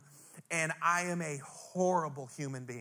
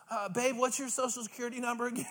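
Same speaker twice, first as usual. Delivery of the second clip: wife's casual question; 7 semitones higher, faster than usual. Retold or imitated. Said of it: "my wife looks at me and goes".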